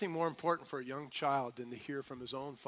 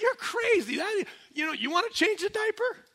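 much higher, louder exasperated shout; exasperated outburst; 9 dB louder than usual. Retold or imitated. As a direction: blurt it out, fed up with the advice. Stressed parent venting at old folks.

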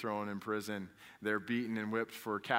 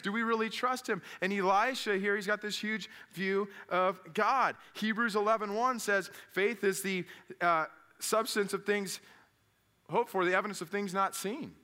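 raised, high-pitched sermon delivery; impassioned preaching; 8 semitones higher, 4 dB louder than usual. Real, not acted. Preacher challenging congregation about relying on God, forceful. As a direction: preach it hard, driving the point home.

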